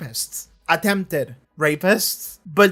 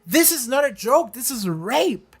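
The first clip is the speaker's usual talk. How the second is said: raised pitch, slowing hard on the last words; imitated indignant confrontation; 4 semitones higher than usual. Katrina confronts the men after breaking free, imitated indignation. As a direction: act out her stern, emphatic call-out. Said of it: "she said it like that".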